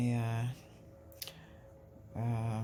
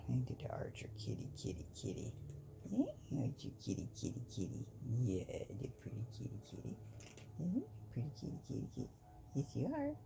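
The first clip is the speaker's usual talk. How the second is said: pet talk; 3 semitones higher, 6 dB quieter, faster than usual. Real, not acted. Vlogger fussing over the cat Archer, soft cooing pet talk. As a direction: coo quietly to a cat in little rushed bursts.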